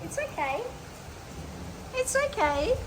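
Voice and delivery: high, soft reassuring coo; calm the scared dog gently; soothing a pet. Real, not acted. Soothing dogs during thunder.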